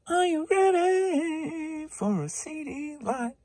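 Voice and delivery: slow, big game-show announcement; announcer catchphrase. Pretend. Reseller hyping his next lot, booming game-show call.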